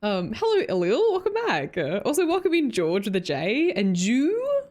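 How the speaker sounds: ordinary delivery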